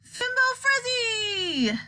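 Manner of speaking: high, drawn-out frenzied cry; frenzied, unearthly scream. A narrator's reading. Wonka crying out to break the silence, frenzied scream. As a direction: scream it high and slow, wildly. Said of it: "Then, in a frenzied, unearthly sort of scream".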